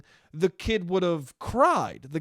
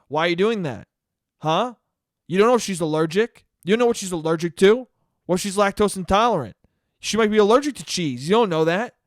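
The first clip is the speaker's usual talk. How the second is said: loud, badgering questions at the usual pitch; imitated scolding mom; 6 dB louder than usual. Mom drilling her son over his sister's cheese, scolding. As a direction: drill the kid with pushy, accusing questions.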